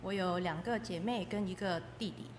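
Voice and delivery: ordinary delivery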